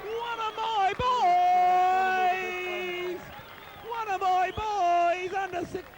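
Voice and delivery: high, loud, drawn-out cheering; excited shout. Real, not acted. Fan cheering a medal winner.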